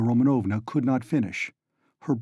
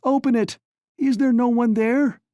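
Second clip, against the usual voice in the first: high, loud frantic shout; desperate high shouting; 12 semitones higher, 5 dB louder than usual. A narrator's reading. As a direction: yell for anyone to hear. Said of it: "she called".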